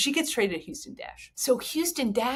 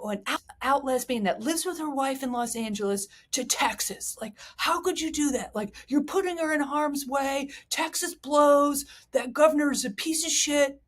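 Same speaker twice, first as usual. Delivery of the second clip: higher-pitched imitation of indignant fans; mock outrage; 6 semitones higher than usual. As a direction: protest like an appalled fan, raised pitch. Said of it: "were outraged".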